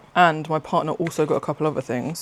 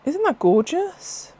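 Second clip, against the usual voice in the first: much higher, slow, cooing over it; delighted gush; 12 semitones higher, slower than usual. Real, not acted. Showing viewers the partner's mushroom ornament, delighted coo. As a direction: melt over how pretty the mushroom is.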